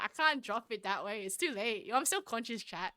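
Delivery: ordinary talk, nothing marked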